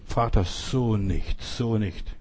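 low, slow, hushed plea; subdued resolve, softly drawn out; retold quiet prayer. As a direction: murmur it privately to the Father.

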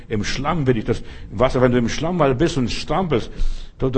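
ordinary talk, nothing marked

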